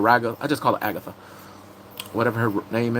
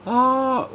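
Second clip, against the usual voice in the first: mock shriek; 13 semitones higher than usual. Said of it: "We're like".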